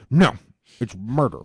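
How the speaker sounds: emphatic "No", then a firm verdict; mildly hammed-up refusal to listen; mock stubborn refusal. Mimicking someone who won't hear anything else, stubborn. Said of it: "you don't wanna hear anything else".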